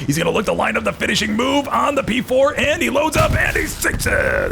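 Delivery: rising to a loud shout as the shot lands; excited play call. Real, not acted. Caster calling the P four kill to viewers, excited shout. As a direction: build the call and explode on the kill.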